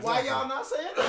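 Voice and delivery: loud laughing question; calling out critics of Kamala with a laugh; laughing challenge. Real, not acted. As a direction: challenge them while laughing hard.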